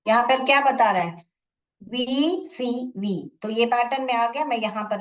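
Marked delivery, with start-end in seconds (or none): 1.8-3.3 each letter drawn out and separate; slow, deliberate letter-by-letter reading; spelled out slowly. Shown as a demonstration. Teacher reads the pattern to pupils, letters slowly stretched.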